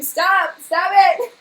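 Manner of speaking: high, loud "stop it"; real flustered cry, rising; overwhelmed protest. Real, not acted. Streamer to a kind viewer, high overwhelmed protest. Overcome by another viewer's kindness.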